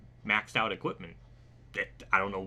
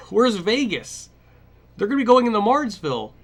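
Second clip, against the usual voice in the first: high, loud, fed-up cry; exasperated outburst; 12 semitones higher, 10 dB louder than usual. Real, not acted. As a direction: throw up your hands and shout.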